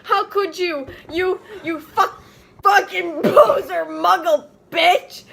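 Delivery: high, loud sobbing shout; furious outburst. Acted. Draco screaming at Ebony, mock sobbing. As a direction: scream it through mock tears.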